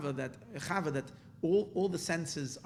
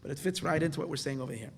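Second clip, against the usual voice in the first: ordinary delivery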